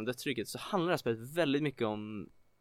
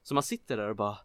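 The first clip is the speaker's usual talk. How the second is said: ordinary talk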